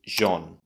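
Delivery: Jean as "zhon", the first sound of genre; Jean said like French "zhon"; rather French. Shown as a demonstration. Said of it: "Sounds rather French".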